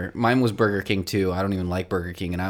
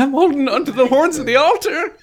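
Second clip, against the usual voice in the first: melodramatic plea; 9 dB louder than usual. Acted. Mock desperate clinging to burgers.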